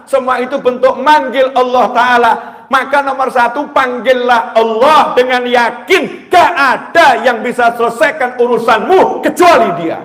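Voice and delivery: high, loud emphatic sermon; fiery sermon shout; preaching at full force. Acted. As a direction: drive the point home to the congregation.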